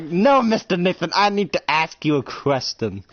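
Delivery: high, loud put-on voice easing downward; silly calling voice. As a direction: playfully insist in a silly voice. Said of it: "Why are you talking in that voice?".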